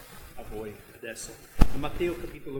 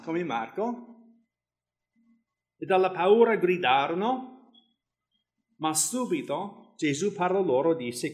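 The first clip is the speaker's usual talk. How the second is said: raised, louder Bible reading voice; scripture reading; 3 semitones higher, 7 dB louder than usual. Acted. Preacher reading the gospel to the church, raised reading voice. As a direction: read the passage out to the congregation. Reads Matthew 14 aloud.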